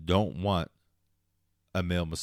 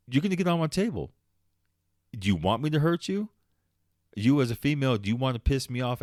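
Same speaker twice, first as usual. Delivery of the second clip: higher, louder, heated; rising anger at the client; getting mad; 6 semitones higher, 3 dB louder than usual. Real, not acted. Ranting to listeners about rude clients, angry and louder. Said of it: "I'm getting mad".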